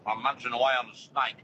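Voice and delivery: talking with a mouthful; words round a bite of candy; eating while talking. Real, not acted. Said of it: "What are you eating".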